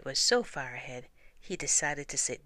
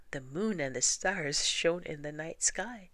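ordinary delivery, nothing marked

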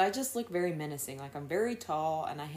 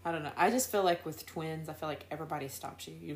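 ordinary talk

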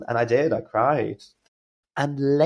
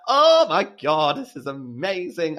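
delighted; 7 semitones higher, slower than usual. Retold or imitated. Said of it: "I was like".

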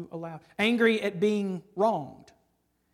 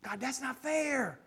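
building protest; 6 semitones higher than usual. Pretend.

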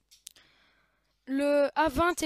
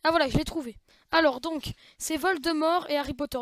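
ordinary talk

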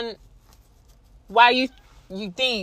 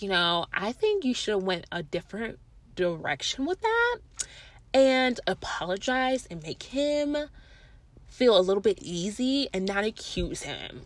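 softer, gentle advice from a friend; softly voiced friend; 8 dB quieter than usual. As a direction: talk her down softly like a calm friend. Friend's calm advice voiced in story.

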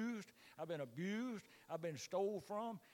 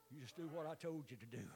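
quiet; 7 semitones lower, 9 dB quieter, faster than usual. Acted. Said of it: "repeated line".

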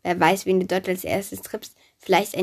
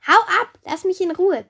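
put-on much higher, louder girl's voice; angry rebuff; 11 semitones higher, 7 dB louder than usual. A narrator's reading. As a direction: yell to drive him away. Daphne rejects the pursuing Apollo. (wütend und laut abweisen)

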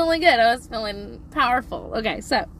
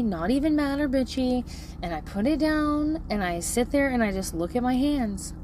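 ordinary talk, nothing marked

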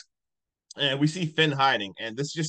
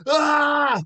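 loud, high-pitched imitated scream; mock yell; 13 semitones higher, 8 dB louder than usual. Imitating the hero as he kills her, high mock yell. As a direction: let out his high, hollering cry. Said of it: "he literally yells".

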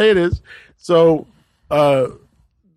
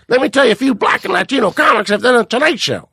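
arguing Leno; 7 semitones higher than usual. Retold or imitated.

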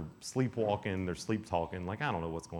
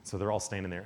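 usual speaking voice

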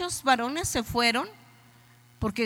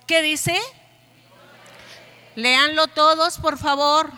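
raised, pushing voice; louder call to the congregation; 5 semitones higher, 10 dB louder than usual. Shown as a demonstration. Pastor urging the congregation to read, calling louder. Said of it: "Más fuerte".

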